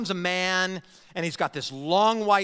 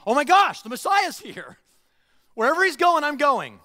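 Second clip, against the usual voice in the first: much higher, louder thrilled voice; real excitement; 9 semitones higher, 8 dB louder than usual. Retold or imitated. True follower seeing the Messiah arrive, loud and thrilled. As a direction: burst out with wholehearted joy. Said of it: "When you think".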